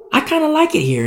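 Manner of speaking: voiced contentment. Servant voiced in a parable.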